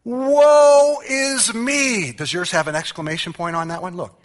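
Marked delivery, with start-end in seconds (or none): 0.0-2.1 long, high, loud wail of woe; drawn-out lament. Retold or imitated. Preacher acting out Isaiah's cry of despair before God. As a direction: stretch it into a slow anguished cry. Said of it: "Then he goes".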